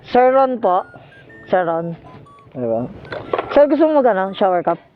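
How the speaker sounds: ordinary talk, nothing marked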